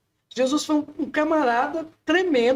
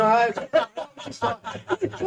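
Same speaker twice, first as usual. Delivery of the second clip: laughing; much slower than usual. Real, not acted. Laughs while answering.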